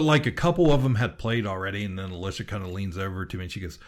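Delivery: usual speaking voice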